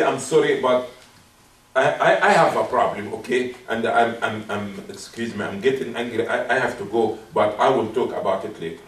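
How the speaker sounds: low, halting, polite excuse; respectful apology. Acted. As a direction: back out of the argument gently. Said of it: "It shows how respectable you are".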